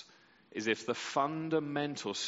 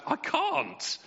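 1 mock defeated cry; 4 dB louder than usual. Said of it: "put your hands up and say".

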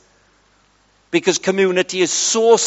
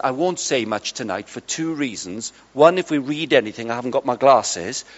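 low, soft-spoken reported voice; imitating a shy man's hushed speech; quiet man; 8 semitones lower, 8 dB quieter than usual. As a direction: voice a reserved man, softly and low. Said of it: "He's a very quiet man".

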